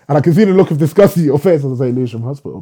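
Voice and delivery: fast, loud tease through a real laugh; amused teasing. Real, not acted. Teasing the listener's disgust at Lewisham, laughing.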